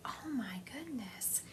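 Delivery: slow breathy whisper; whispered exclamation. Retold or imitated. Medium relays aunt spirit's exclamation as a slow whisper. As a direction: hush it out slowly, in awe. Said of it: "she's like".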